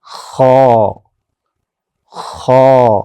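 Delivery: خو with a rasping, heavy throat sound; thick kho. Shown as a demonstration. Teacher modelling the thick throaty sound of خو. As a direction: scrape خو thickly from the back of the throat. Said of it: "تھوڑی موٹی آواز ہے".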